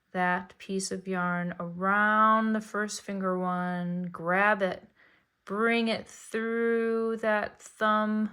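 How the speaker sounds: slow, careful step-by-step narration; slow narrated demo. Shown as a demonstration. Teaching a beginner to cast on, slow and careful. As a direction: talk each step through slowly. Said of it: "I'll narrate again".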